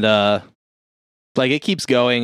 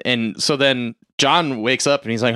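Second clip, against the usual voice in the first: usual speaking voice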